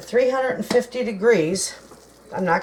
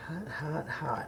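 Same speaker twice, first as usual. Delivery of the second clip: hushed whisper of hot hot hot; 2 semitones lower, 14 dB quieter, slower than usual. Real, not acted. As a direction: breathe it out unvoiced. Burning fingers on fresh rolls.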